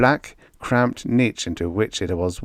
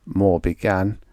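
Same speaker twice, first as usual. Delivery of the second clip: ordinary delivery, nothing marked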